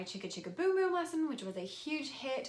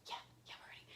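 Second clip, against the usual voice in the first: put-on kids' eager whisper; 16 dB quieter, much faster than usual. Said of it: "And they're like".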